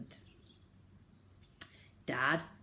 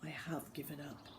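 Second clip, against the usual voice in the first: much softer, defeated; sadly; 3 semitones lower, 12 dB quieter than usual. A narrator's reading. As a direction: let it sink out of him. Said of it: "said Dad sadly".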